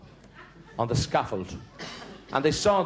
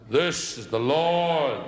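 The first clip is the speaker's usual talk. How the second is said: mock voice of God; much slower than usual. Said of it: "And he tries".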